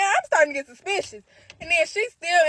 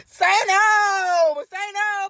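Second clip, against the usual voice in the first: loud, high cry of say no; urgent shout; 7 semitones higher, 5 dB louder, much slower than usual. Real, not acted. Comic pleading with the audience, crying out. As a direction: beg the crowd to say no. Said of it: "urging the audience".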